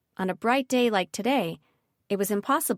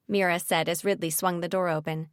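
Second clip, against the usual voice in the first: ordinary delivery, nothing marked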